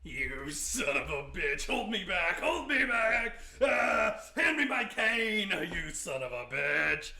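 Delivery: loud, slow, hammed-up fury; mock old man rage. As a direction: play a frail old man itching for a fight. Old man wanting to fight.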